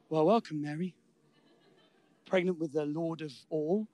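low, soft and proper mock greeting; a restrained, polite hello; prim polite welcome. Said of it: "No, in a loud voice".

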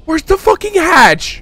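high, loud frantic demand; frantic shout. Real, not acted. Player in horror game hunting for the hatch, frantic shout. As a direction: shout it in exasperated panic.

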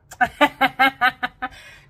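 loud, high cackle; loud laugh. Real, not acted. Vlogger laughing at her friend joke, loud cackle. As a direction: crack up loudly.

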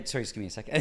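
soft distracted mumble; muttering. Shown as a demonstration. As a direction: mutter it as an aside. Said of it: "You kinda, like, start muttering".

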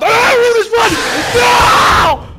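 very loud high scream; desperate shout. Real, not acted. Caught by the enemy, desperate screaming. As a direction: scream in despair.

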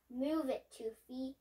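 ordinary delivery, nothing marked

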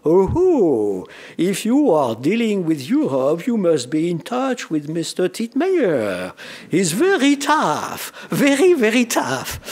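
a hammed-up Barre impression that stretches out very, very tough; Raymond Barre impression. Recalling Barre's remark about Titmeyer, a mock impression of Barre's accent. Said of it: "with his very personal accent".

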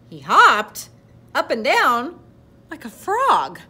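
high, loud, bouncing phrases; excited narration. A narrator's reading. Reader describing the delighted frog hopping, excited. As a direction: punch each phrase like a hop.

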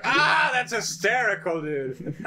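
high, loud, laughing mock praise. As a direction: cackle it out like it's the best joke. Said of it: "You're like".

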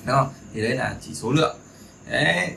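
ordinary delivery

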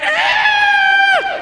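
long, very high, loud squeal; imitated wife's scream. Imitating wife with hands in the air, screaming. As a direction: mimic a thrilled scream on the drop. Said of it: "my wife is hands in the air like".

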